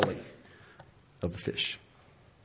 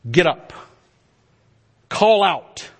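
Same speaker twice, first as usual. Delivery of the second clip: louder, firm echo of the command; voice of God's command; 4 semitones higher, 5 dB louder, slower than usual. Acted. God commanding Jonah, firm and louder. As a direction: say it as God's call. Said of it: "the very words that God had spoken".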